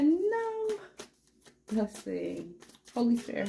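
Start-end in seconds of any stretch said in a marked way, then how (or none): 0.0-0.8 much higher, petulant refusal; high mock-bratty no; bratty bird voice. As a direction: squeak a bratty no. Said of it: "She said".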